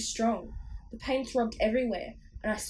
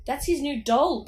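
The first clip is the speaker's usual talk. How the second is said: loud, higher exclamation; exclaimed; 5 semitones higher, 7 dB louder than usual. A narrator's reading. Sister telling the parents about the doll, exclaimed. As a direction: blurt it out loudly. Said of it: "Patty exclaimed".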